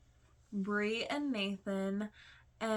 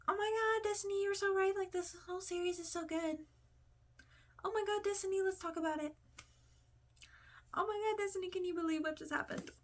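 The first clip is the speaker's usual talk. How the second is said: high, squealing mock fangirl; gushing fan comment; 11 semitones higher, 4 dB quieter, faster than usual. A viewer gushing in the comments, mock squealing fangirl. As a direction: play an over-the-top reader gushing to Destiny. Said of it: "in the comments being like".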